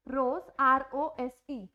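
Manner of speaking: रोज, then R O एस E spelled letter by letter, slow; spelled slowly. Shown as a demonstration. Teacher spells रोज slowly for students. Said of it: "हम इसको ज की sound देते हैं".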